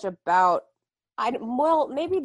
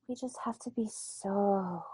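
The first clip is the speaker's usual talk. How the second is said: sultry whisper. Retold or imitated. Teacher helping Josh Peck clean up, sultry whisper. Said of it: "she's like".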